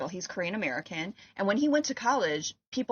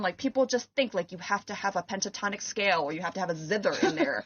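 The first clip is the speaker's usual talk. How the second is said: usual speaking voice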